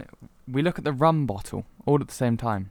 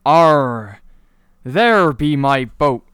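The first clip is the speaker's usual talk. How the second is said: loud, slow pirate call; 3 semitones higher, 10 dB louder, much slower than usual. Acted. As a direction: roar it out like Blackbeard at sea. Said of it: "and he goes".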